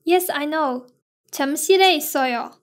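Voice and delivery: normal talking voice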